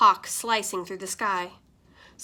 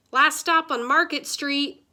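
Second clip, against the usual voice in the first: louder and higher, calling to the passengers; called out; 6 semitones higher, 5 dB louder than usual. A narrator's reading. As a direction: announce the stop across the bus. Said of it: "Mister Dennis called".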